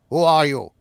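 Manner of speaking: loud British demand, as the prisoner; forceful TV-drama British accent. Acted. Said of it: "more of an actual British accent".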